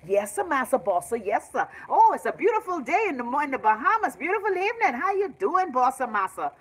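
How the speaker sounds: high, fawning bellman voice; overly sweet servile greeting; servile hotel worker. Retold or imitated.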